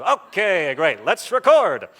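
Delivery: loud, high mock-cheerful announcement; exaggerated enthusiasm. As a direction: burst out brightly, hamming up the eagerness. Staff switch from secrecy to eagerness.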